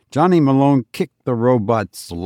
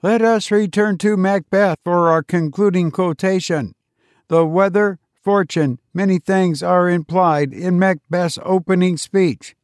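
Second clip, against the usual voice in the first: higher, measured robot teacher; robot teacher explaining witches to the class, higher and measured; 7 semitones higher than usual. A narrator's reading. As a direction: lecture like a patient machine.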